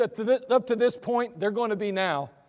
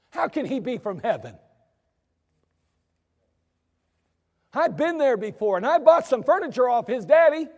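hammed-up hometown skeptic; skeptical townsfolk; 5 semitones higher than usual. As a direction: scoff like a neighbor who knew him as a boy. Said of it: "and they say".

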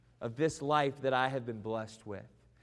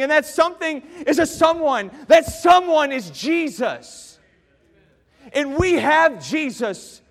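preaching at full force; 12 semitones higher, 16 dB louder than usual. Acted. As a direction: thunder the gospel to the congregation.

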